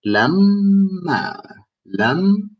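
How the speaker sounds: LAMMA pitched high with the m held long; double m. Shown as a demonstration. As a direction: stretch and split LAMMA at the double m. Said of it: "It's a double sound".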